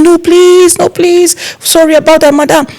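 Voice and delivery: high, pleading no please; pleading relatives. Retold or imitated. Family members begging the planner to stay, high pleading. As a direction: beg her not to leave, voice up high. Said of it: "They said, like".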